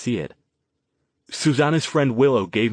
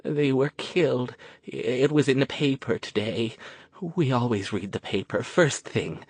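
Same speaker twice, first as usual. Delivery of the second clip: quavering; 3 semitones higher than usual. A narrator's reading. Old woman continues, quavering.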